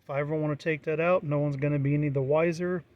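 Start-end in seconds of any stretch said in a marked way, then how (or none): none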